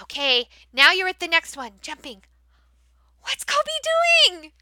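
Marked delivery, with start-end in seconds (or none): 3.2-4.5 much higher, slow, sing-song amazement; animated wonder. Shown as a demonstration. Teacher asking young learners about the animation, amazed. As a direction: make it a big exciting mystery.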